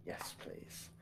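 breathy whispered plea; whispering. Acted. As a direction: whisper the yes please. Repeats the request in a whisper.

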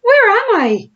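very high, loud squealing panic; crocodile's dizzy cries; dazed panic. A narrator's reading. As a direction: shriek in confusion. Crocodile knocked over, dazed.

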